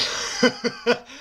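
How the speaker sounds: squeaky burst of laughter; high giggle. Real, not acted. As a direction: laugh at own impression. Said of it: "I'm sorry".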